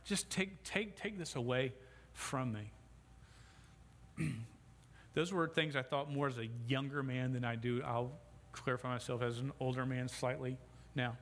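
usual speaking voice

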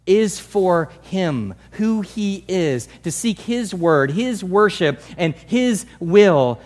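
each phrase drawn out and pressed; slow emphatic stress. Real, not acted. Preacher to his church, slow pressed emphasis. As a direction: hammer every word slowly.

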